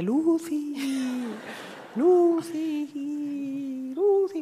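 high, eerie drawn-out ghostly call. Retold or imitated. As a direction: moan the name like a ghost. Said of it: "voz fantasmal que dice".

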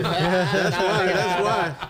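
teasing through a laugh. Real, not acted.

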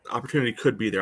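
normal talking voice

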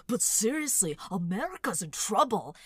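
loud earnest host warning; John Oliver impression. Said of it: "John Oliver impression".

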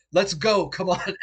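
high, loud hurrying shout; urgent call. Acted. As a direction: urge them to run right now. Jack urges player to flee.

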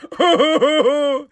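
high fake boo-hoo wail; hammed-up "oh-ho-ho" sobs; mock sobbing. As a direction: bawl sarcastically like a crybaby. Mocking players crying over coach.

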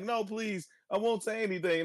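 high mock pleading voice; high, whiny plea from a captive iPad; pleading Stan. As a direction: squeak out a begging plea.

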